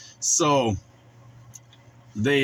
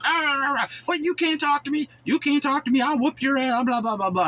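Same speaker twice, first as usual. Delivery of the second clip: much higher, squeaky mock tantrum; mocking the angry predator; 13 semitones higher than usual. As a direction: ham up a petty inmate throwing a fit. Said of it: "He's just like".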